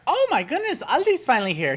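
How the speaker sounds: high-pitched delighted exclamation; excited. Retold or imitated. YouTuber acts out spotting the store, excited. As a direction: gush in a high swooping voice.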